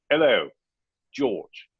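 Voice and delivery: hammed-up, slow Cockney caller; Cockney accent. Caller asking a question at office hours, mock Cockney accent. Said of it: "in a Cockney accent".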